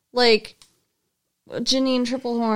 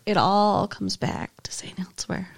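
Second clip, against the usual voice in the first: whispering; 3 semitones lower, 5 dB quieter than usual. Real, not acted. Resigned aside.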